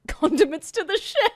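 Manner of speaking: high, loud mock exclamation; hammed-up joke shout; shouted meme catchphrase. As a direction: belt it out like a punchline.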